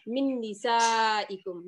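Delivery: من نسائکم drawn out slowly, each syllable long; teacher reads the verse word by word slowly for students; slow Quranic recitation. Shown as a demonstration. Slow careful recitation for the class. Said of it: "من حرف جر".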